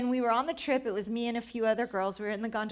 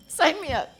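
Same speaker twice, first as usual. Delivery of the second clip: higher, through a laugh; 7 semitones higher, 9 dB louder than usual. Real, not acted. Laughing as she signs up for the challenge. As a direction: toss it off laughing.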